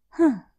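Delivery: loud sharp huh; surprised huh. Acted. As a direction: burst out huh. Girl's reaction.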